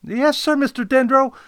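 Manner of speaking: high, loud obedient sidekick; assistant Kodet. Acted. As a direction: answer smartly in a high loud voice. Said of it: "Kodet replied".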